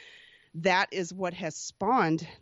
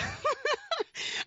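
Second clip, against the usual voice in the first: bright burst of real laughter; 6 dB quieter than usual. Real, not acted. Laughing at the interviewer's question. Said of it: "And I'm laughing because".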